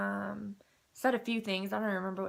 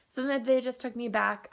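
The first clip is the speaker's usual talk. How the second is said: ordinary talk, nothing marked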